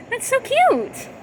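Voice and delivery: high, slow gushing teacher voice; delighted young teacher. A narrator's reading. Young teacher gushing over her class, high and slow. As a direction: coo it like a thrilled new teacher. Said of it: "she was delighted".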